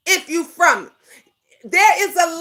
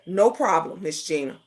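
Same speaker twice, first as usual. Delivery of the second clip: quiet quote; 9 semitones lower, 6 dB quieter than usual. Retold or imitated. Quoting Fi's reply.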